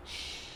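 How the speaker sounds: soft drawn-out shush; hushing shh. Acted. Teacher voices a story's hidden speaker hushing, soft shush. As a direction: hush them with a long, quiet shh. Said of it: "some voice is saying".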